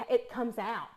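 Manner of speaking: usual speaking voice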